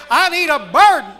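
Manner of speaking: loud, high, urgent plea to God; pleading shout. Acted. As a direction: cry out the need like a preacher in full force. Preacher voicing a pleading prayer.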